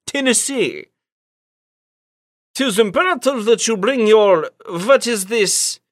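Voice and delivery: put-on high British voice doing a Russian accent; hammed-up Russian accent over British; Uncle Penny Codsworth Manshire. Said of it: "a British man doing a Russian accent".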